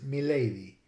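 milady as "mih-LAY-dee"; short-vowel milady. Shown as a demonstration. Lecturer concluding on the word, milady with short I. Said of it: "but the I became short".

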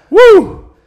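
loud, high celebratory whoop; triumphant whoop. Real, not acted. Player celebrating the werewolf's defeat, whooping. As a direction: let out a high, loud "woo".

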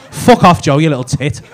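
loud playful snap of fuck off, then easing; fake-angry shout at a mate, half laughing; mock outburst. Teasing Joe about his muscles, a loud mock outburst.

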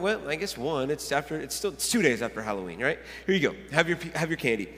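low, soft, offhand concession; relenting dad. Retold or imitated. Dad handing candy to his four-year-old, low-key and relenting. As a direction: casually give in, voice dropped. Dad giving in to child's request.

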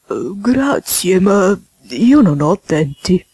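slow, drawn-out toad voice; slow toad declining an offer; toad character voice. Acted. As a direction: play the toad guest, stretching every word out. Voices Mr. Jackson.

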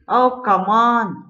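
loud, slow groan of frustration; exasperated shout. Real, not acted. The car glitches again, exasperated loud complaint.

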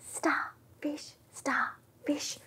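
faint unvoiced whisper. Shown as a demonstration. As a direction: barely breathe the words. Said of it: "Super quiet little starfish".

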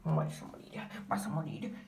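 low, soft threatening whisper; menacing whisper. Pretend. (susurro amenazante)

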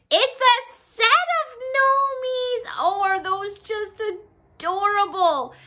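high, slow singsong squeal; gushing reveal. Real, not acted. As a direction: coo over them in a drawn-out high voice. Gushing reveal of the gnome set.

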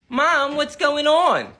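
normal talking voice

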